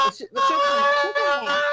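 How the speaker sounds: very high, loud squeal; high pitch. Real, not acted. Streamer defending an absent friend, voice jumping high and loud. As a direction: let the voice shoot up into a loud squeak. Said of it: "I got a little hit, h-, high pitch there".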